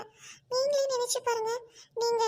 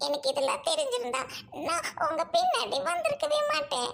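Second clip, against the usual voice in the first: ordinary talk, nothing marked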